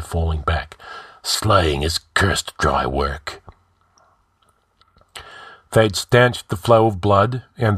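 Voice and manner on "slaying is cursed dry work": slow, low grumble; grunting. A narrator's reading. Wounded king falling back after his drink, grunting. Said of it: "he grunted".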